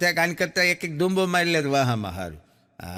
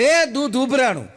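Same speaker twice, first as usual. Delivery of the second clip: mock alarm shout, higher and louder: એ દૂધ ઉભરાણું; 6 semitones higher, 4 dB louder than usual. Shown as a demonstration. Husband shouting to wife, loud mock alarm. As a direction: call out the milk boiling over in a loud mock alarm. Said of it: "જોરથી રાડ પાડવાની".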